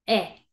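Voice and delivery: brief "E" as eh; short E sound. Shown as a demonstration. Teacher naming the short sound, brief "E". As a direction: cut "E" short, a quick eh. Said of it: "Sonido corto".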